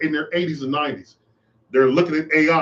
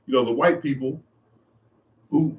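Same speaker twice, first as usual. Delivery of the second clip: ordinary talk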